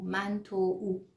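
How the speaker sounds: normal talking voice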